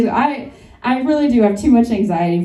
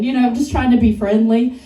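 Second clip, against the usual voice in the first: ordinary talk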